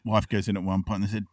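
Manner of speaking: normal talking voice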